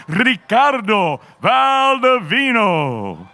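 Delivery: long, stretched, booming name call; drawn-out fighter introduction. Acted.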